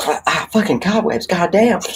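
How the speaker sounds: high, spluttering curse at cobwebs; squeaky, fed-up grumble; annoyed character. Retold or imitated. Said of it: "he's always like".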